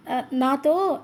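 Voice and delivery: "ఆ, నాతో," broken, with the syllables stuck and restarted; mild stutter on "నాతో"; stammering. A narrator's reading. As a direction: catch on "నాతో" before getting it out. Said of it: "ఆగి ఆగి అవస్థలు పడుతూ".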